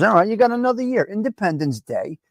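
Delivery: usual speaking voice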